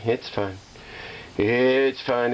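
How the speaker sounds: ordinary talk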